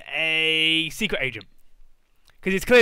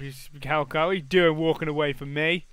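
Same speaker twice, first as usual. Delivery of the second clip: ordinary talk, nothing marked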